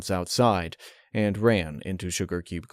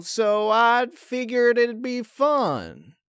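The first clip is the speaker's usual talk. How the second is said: higher, slow country mare voice; Applejack voice; 9 semitones higher than usual. Acted. Applejack inviting Twilight over, higher and carefully casual.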